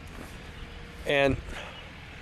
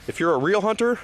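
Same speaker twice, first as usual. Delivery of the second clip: higher and pushed harder on hunter; rising challenge; 7 semitones higher than usual. Real, not acted. Vlogger challenging hunters to prove themselves, raised challenge. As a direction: call the hunters out, voice climbing.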